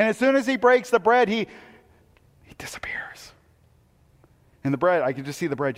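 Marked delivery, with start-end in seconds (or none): 2.4-3.3 breathless whisper. Acted. Preacher whispering to the congregation that Jesus vanished. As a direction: let the word vanish into a whisper.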